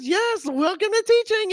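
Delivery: mock-motherly high holler; gleeful mom. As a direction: impersonate mom crowing a welcome. Mom greets crying new teacher.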